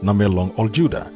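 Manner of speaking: ordinary delivery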